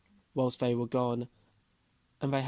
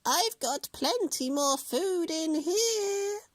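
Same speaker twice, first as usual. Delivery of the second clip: sweet witch voice; slower than usual. A narrator's reading.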